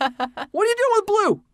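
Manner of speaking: high, squeaky scandalized question; squeaky shocked voice. Acted. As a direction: shriek it like a startled parent. Acting a shocked parent.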